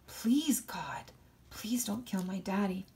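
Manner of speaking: desperate whispered plea; whispered prayer. Acted. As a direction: whisper the prayer under breath. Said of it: "I prayed to God".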